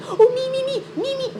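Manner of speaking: high, squeaky 'me, me' of someone desperate for tea; eager tea grabber. Retold or imitated. Mocking chat members who all want tea, squeaky and eager. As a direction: pipe up like an overexcited kid begging for tea. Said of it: "self-proclaimed free thinkers when asked".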